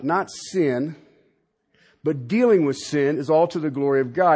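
ordinary talk

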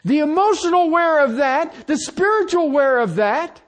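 high, loud preacher's cry; preaching at full force. Acted. Pastor crying out to his congregation in a full preaching voice.

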